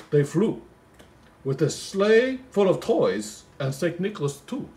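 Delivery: slow, lilting Christmas poem reading; poem recital. Acted. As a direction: read it like a bedtime Christmas poem.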